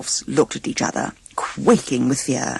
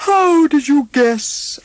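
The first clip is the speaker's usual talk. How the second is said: slow, much higher sleepy yawn; yawning drowsily; 12 semitones higher, 5 dB louder, slower than usual. A narrator's reading. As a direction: stretch the words out through a yawn. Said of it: "yawning drowsily".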